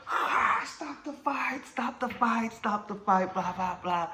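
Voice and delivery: shrieking stop the fight way up high; panicked driver's high yell; high frantic bus driver. Retold or imitated.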